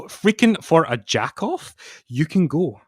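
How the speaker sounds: swinging between loud declaiming and near whisper; hammed-up dramatic reading of wrestling lyrics. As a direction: read the lyrics like a dramatic poem. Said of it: "do a dramatic reading of the lyrics".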